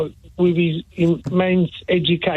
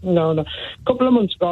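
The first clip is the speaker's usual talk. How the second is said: normal talking voice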